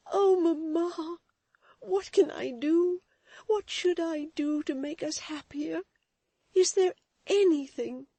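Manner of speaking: high, loud, tearful pleading; tearful plea. Acted. Florence pleading with her weeping mother. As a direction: beg to help through tears.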